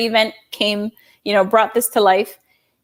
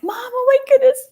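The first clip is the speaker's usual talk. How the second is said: high, thrilled cry of Mom; excited, high call to mom; 4 dB louder, faster than usual. Retold or imitated. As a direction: gush to her mother. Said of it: "I called my mom and I was like".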